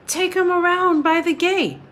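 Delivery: a high call across the yard. Acted. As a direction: call it out to the rider over the wall. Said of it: "She called".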